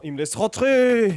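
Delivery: loud, high and slow with delight; gleeful. Real, not acted. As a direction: savor the win. Dice succeed.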